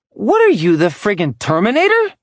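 loud, high incredulous shout; shocked outburst. Acted. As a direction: yell it in disbelief. Shocked woman confronting intruder.

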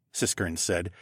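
usual speaking voice

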